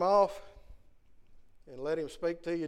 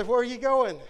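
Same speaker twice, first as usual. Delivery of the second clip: higher, louder challenge from a backseat driver; questioning backseat driver; 6 semitones higher, 7 dB louder than usual. Acted. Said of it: "wanna be a backseat driver".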